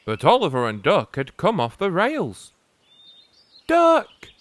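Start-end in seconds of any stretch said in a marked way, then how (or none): 3.7-4.3 high, loud cry of a name. Acted. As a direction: call out in alarm after the crash. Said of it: "cried Oliver".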